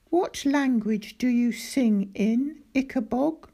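put-on a curious young girl asking up; Daisy's voice. A narrator's reading.

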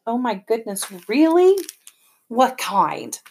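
thrilled by the news on the phone; excitedly. Acted. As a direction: mom delighted, voice jumping up on really. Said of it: "she said excitedly".

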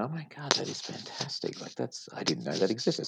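soft, high-starting mock amazement; imitated amazement. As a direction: imitate a customer discovering something wonderful. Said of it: "And then they go".

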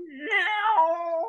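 long high meow; cat meow. Retold or imitated. Imitating the cats starting to meow at dawn, high. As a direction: mew like a waking cat. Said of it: "the cats all start meow".

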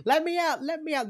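high, loud, frantic pleading; mock-panicked banging at the door. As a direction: plead to be let out, high and desperate. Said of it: "banging on the chain door".

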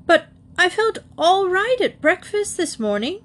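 high, anxious protest; bewildered, high-pitched alarm. A narrator's reading. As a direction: plead innocence in a high, worried voice. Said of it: "looking more and more alarmed".

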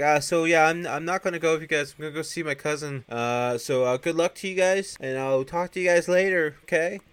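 high, loud, overly breezy goodbye; imitated excuse-making. Harmony bowing out after swapping sacks, fake breezy. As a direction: put on an innocent sing-out farewell.

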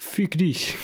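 put-on high American-accented fick dich; mock American swearing in German. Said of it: "typisch amerikanischen Akzent".

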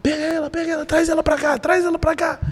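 high-pitched urgent orders; retold urgent call for help. Retells urgently ordering people to fetch her.

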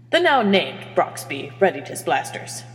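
plain reading voice

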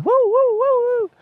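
very high, whooping fishing cheer; mock wahoo cheer. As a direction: whoop like the crew landing a big fish. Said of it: "everyone's wahooing".

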